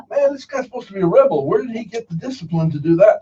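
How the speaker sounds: high, disbelieving rant; incredulous. Retold or imitated. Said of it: "I go".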